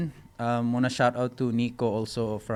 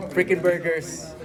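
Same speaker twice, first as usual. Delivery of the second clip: slow, drawn-out sponsor shout-out; hype announcer; 6 semitones higher, 5 dB louder, slower than usual. Acted.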